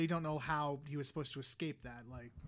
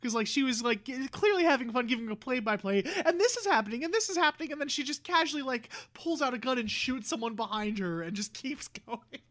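excited; 4 semitones higher, 5 dB louder than usual. Real, not acted. Excited retelling funny scene.

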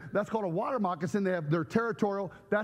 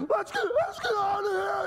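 panicked flight; 3 dB louder than usual. Retold or imitated. Woman fleeing with child.

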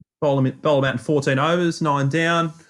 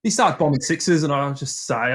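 usual speaking voice

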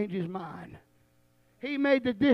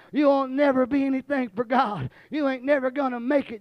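ordinary talk, nothing marked

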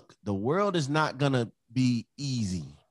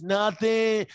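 higher-pitched imitation of a guilty kid; kids' denial; 8 semitones higher, 4 dB louder than usual. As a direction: play a kid denying it from another room. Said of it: "the first thing they'll say".

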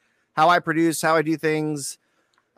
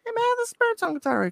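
mock indignant realization; faster than usual. Realizing while listening to record.

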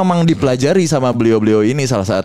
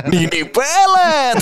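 stretched, high and slow like a TV ad announcer; announcing a horror name in a bright ad voice; cheerful ad-voice intonation; much slower than usual. Retold or imitated. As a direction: sing out the name like a happy commercial. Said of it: "Kalau Nini Peletnya intonasi Maizon".

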